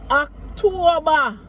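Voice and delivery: "October" with the ER ending as an open A, Octoba; Jamaican patois October. Shown as a demonstration. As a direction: end "October" on A, no R. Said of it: "change that E R to an A".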